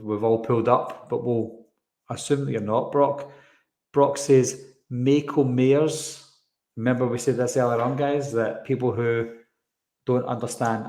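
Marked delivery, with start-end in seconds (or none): 4.9-6.3 "Mako Mayers" read slowly with "ay" vowels in both words; imitating a listener's mishearing, "Mako Mayers"; mocked mispronunciation. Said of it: "listen to other people's accents in their own".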